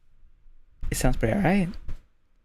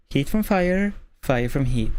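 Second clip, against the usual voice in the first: warm-up phrase. Shown as a demonstration. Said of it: "So before every stream, I go".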